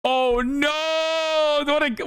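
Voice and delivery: high, drawn-out cry of dismay; mock dismay. Real, not acted. Streamer reacting to the anchor's opening line, mock dismay. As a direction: wail it in gleeful horror.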